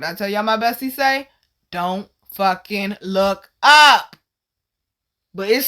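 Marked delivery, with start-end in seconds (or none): 1.7-4.2 very slow, "Don't fucking look up" word by word; emphatic warning. Retold or imitated. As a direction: spell out "Don't fucking look up", hitting "up" loud. Retold warning from bestie.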